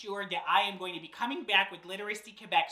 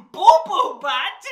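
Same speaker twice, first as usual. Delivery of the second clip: slow, high, loud disbelieving laugh; laughing in shock at the silly name; incredulous; 8 semitones higher, 13 dB louder, slower than usual. Acted. Reacting in disbelief to reading.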